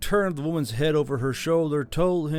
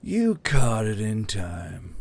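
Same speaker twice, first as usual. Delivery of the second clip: put-on lower, slow old man; 4 semitones lower, slower than usual. A narrator's reading. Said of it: "the old man said with a wink".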